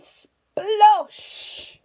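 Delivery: high, loud exclaimed splosh; big splash. A narrator's reading. Robbers step into the duck pond, loud high splosh. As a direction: burst it out like a splash.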